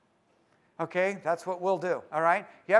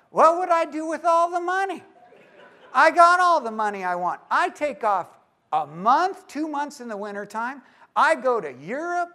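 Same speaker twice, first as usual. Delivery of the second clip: much higher and louder with laughter, hammed-up; carefree pie man; 10 semitones higher, 10 dB louder than usual. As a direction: laugh off the idea of more money. Pie man mocks more money.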